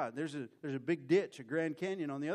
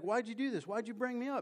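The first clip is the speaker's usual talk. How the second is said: higher, pointed why-did-you complaint; Samuel irritated; 8 semitones higher than usual. Acted. Samuel asks Saul why he brought him up, high and irritated. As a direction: put out at being called back.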